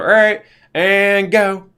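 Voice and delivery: high, loud, slow "and go"; cameraman cue. Retold or imitated. A crew guy starting the tape, high, loud and slow. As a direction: call action like a cheap cameraman. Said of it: "VHS thing in the camera recorder".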